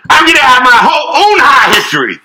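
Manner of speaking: loud, high cartoon robot brag; Bender voice. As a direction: belt it out like Bender. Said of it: "He, I think maybe he would say something like".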